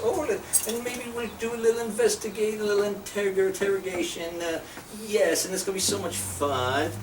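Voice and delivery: mock German movie villain. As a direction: play a sadistic film German relishing the torture. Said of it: "you know, like".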